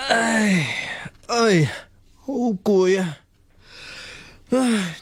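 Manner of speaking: high weary sighs, slow on 好攰啊; exhausted groan. Acted. Student alone at night, worn out, groaning high and slow. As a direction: sigh out the tiredness after a long night.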